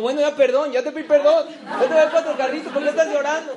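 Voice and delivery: loud, high-pitched, exasperated outburst; retold exasperated plea. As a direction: plead loudly in disbelief at his tears. Acted exasperated apology to a crying man.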